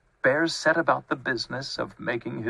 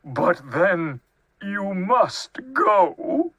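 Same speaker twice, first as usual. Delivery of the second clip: slow, tearful bear, rising high; sniffling; 6 semitones higher, much slower than usual. A narrator's reading. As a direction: plead through sniffles, drawing out the words. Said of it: "he sniffled".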